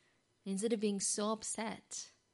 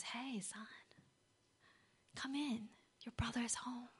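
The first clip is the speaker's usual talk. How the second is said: gentle father; 5 semitones higher, 7 dB quieter than usual. Acted. Father gently calls son inside.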